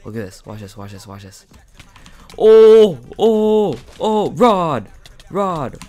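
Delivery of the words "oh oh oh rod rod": high, loud startled shouts; shrieking in shock mid-fight; panicked yelling. Real, not acted. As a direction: scream it as the fight erupts. Sudden fight, panicked exclaim.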